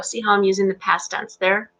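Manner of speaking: usual speaking voice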